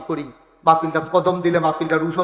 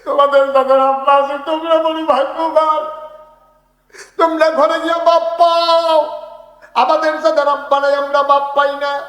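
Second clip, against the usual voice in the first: high, loud wailing sermon chant; full-force lament; 10 semitones higher, 8 dB louder than usual. Real, not acted. Fatherless preacher to those who have fathers, loud wailing chant. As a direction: cry out your loss to the whole crowd.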